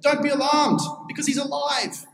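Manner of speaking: high-pitched announcement of good news; calling out. Retold or imitated. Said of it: "he yells out".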